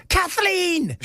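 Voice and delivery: high shouted call of a name, Irish lilt; Irish husband bellowing for his wife; screamed name in Irish accent. Retold or imitated. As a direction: yell across the house like an Irish husband. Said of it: "He just screams".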